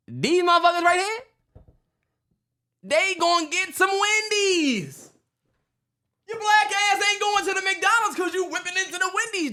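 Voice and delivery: high, slow, teasing hype voice, up to a shout; mock hype voice. Teasing his cohost about Wendy's, high mocking hype voice. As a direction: draw the words out high and mocking, then yell.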